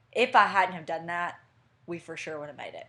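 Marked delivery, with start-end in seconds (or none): none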